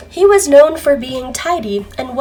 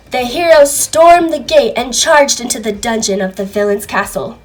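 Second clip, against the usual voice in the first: usual speaking voice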